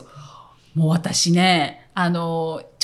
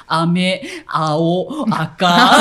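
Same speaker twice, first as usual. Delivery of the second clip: Kansai intonation; 3 semitones lower, 4 dB louder, much slower than usual. Retold or imitated. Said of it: "日本人の先生が授業やってるプロモーションビデオ".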